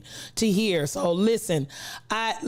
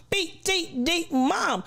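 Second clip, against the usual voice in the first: high, drawn-out name announcement; playful name roll-call; 9 semitones higher, slower than usual. Real, not acted. Streamer greeting a viewer by name, playful roll-call. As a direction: stretch each part up high, slowly.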